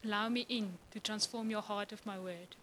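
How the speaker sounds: soft, tender, low invitation; gentle God. Retold or imitated. God quietly asking to transform her heart. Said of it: "God comes and he says".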